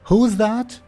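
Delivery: high woman calling from upstairs; loud, high female character voice; woman's voice. Acted. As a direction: call out loudly, pitched well up. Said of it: "you hear a woman's voice".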